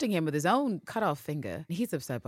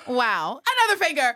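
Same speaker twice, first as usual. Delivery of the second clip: mock glee; 10 semitones higher, 9 dB louder than usual. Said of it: "she's like".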